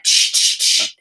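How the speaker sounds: unvoiced shh like a toddler scribbling; hushed shushing. Toddler shushing while drawing on the wall. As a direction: shush it out breathlessly. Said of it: "he went".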